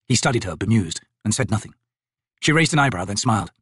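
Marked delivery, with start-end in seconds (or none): none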